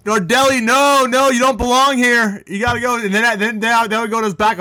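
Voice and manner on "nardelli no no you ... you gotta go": much higher mock teacher voice, slowing on the order; a put-on coach shooing a student away; imitating the teacher. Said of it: "That's how he talked, too".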